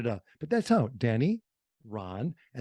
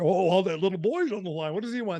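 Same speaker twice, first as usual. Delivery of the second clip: Santa voice; 5 semitones higher, faster than usual. Retold or imitated. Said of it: "I would be Santa".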